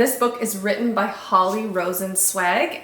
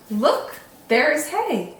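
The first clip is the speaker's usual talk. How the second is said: lightly excited, raised-pitch call; mild exclamation; 7 semitones higher than usual. A narrator's reading. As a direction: point it out with a touch of excitement. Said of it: "exclaimed Sunday".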